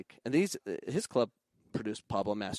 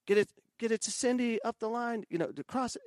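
high-pitched imitation of a coach calling plays; imitated sideline instructions; mock coach instructions; 13 semitones higher than usual. Imitating coaches dictating every play.

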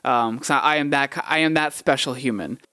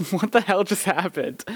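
high-pitched, laughing bafflement; amused disbelief; 8 semitones higher than usual. Retold or imitated. Driver reacts after the cop leaves, laughing disbelief. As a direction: retell the bafflement through a laugh.

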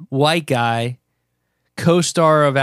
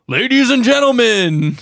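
slow, loud, higher announcer call; 9 semitones higher, 5 dB louder than usual. Pretend. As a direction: introduce the show like a big broadcaster. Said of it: "mad broadcastery".

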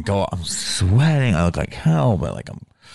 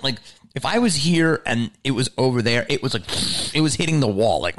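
normal talking voice

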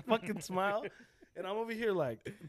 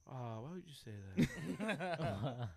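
a softly voiced let-down teacher; disappointed teacher; 2 semitones lower, 6 dB quieter, slower than usual. Teacher reads the kid's swearing on paper, soft imitated disappointment. Teacher's reaction on reading the note.

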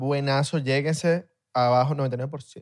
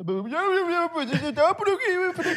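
squeaky high voice; 14 semitones higher than usual. Pretend. Playful high voice.

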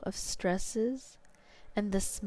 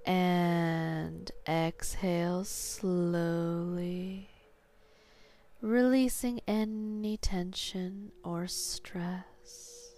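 sleep story narrator; slower than usual. Acted. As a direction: guide them down into sleep, slowly. Guided hypnosis induction.

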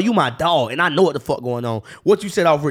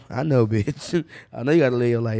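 ordinary delivery

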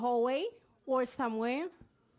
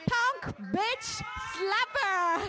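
drawn out slowly and higher; hype punchline; 6 semitones higher, much slower than usual. Acted. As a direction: land the last title with a hyped-up stretch. Hype introduction of comedian.

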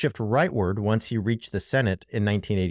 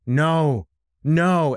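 retold dismay; 3 semitones higher, 4 dB louder, much slower than usual. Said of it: "He's like".

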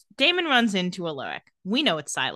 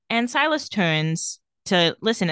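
ordinary talk

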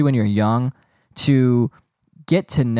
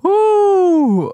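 loud, very high whoop of laughter; 7 dB louder than usual. Real, not acted. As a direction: let out a shrieking woo of laughter. Laughing fit.

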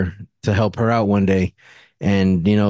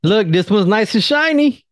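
higher, playful salesman tone; mock husband voice; 10 semitones higher than usual. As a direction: hype up the shiny lipstick. Teasing voice of husband.

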